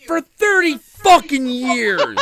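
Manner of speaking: high, loud, laughing rant; laughing exasperation. Real, not acted. Telling friends about dad's young wife, a loud laughing rant. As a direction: rant in disbelief, half laughing.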